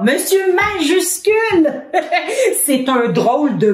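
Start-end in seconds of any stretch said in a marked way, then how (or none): 0.0-2.6 higher, laughing at own slip; laughing self-correction. Real, not acted. Teacher laughing after mixing up the name. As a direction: catch yourself and laugh. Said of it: "qu'est-ce que je dis là?".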